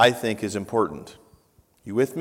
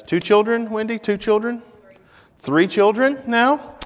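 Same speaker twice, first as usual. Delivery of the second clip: raised teasing voice; 11 semitones higher, 5 dB louder than usual. Real, not acted. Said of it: "teasing question to the congregation".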